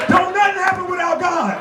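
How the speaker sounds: high, exclaiming praise; fervent believer. Acted. Churchgoer suddenly loving the church, high fervent echo. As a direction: cry it out high, newly converted.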